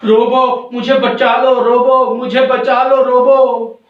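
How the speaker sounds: high, loud acted cry for rescue; storybook shriek for help; screaming for help. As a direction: cry out in panic to be saved. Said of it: "सुपंखी चिल्लाती हुई आती है".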